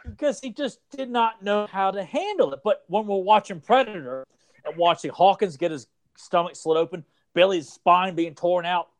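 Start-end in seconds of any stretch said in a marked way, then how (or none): none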